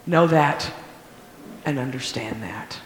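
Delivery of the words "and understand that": soft whispered close; whispered final plea. Real, not acted. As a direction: hush it down to a whisper. Whispered closing exhortation.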